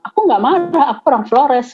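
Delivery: lightly hammed-up husband insisting he's not angry; imitating husband's forceful reply. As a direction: echo his blunt eastern-Indonesian manner, half-joking. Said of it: "kalau ngomong kan keras ya, ngegas".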